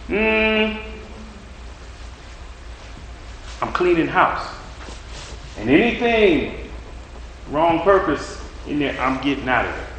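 rhythmic, call-and-response sermon delivery; preaching. Acted. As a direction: preach it to the congregation.

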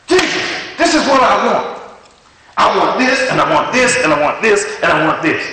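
high, pushy demands list; demanding Jesus. Retold or imitated. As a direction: make demands like a spoiled child. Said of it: "I started coming at Jesus like".

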